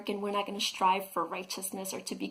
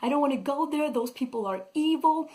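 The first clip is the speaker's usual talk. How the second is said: higher, whiny refusal to go; reluctant Jonah; 6 semitones higher than usual. Retold or imitated. Jonah refusing God's command to go, whiny and high. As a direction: play Jonah balking at God's order. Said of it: "And Jonah's like".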